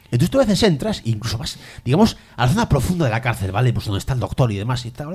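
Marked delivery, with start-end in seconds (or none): none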